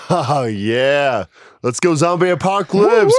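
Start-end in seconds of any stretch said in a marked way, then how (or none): 1.6-3.1 higher, louder hyped shout; excited shout. Real, not acted. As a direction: yell it to pump everyone up. Hyping zombie apocalypse.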